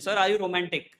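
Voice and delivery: normal talking voice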